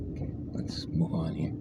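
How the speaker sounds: unvoiced whisper. Real, not acted. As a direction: whisper it low. Sudden whisper.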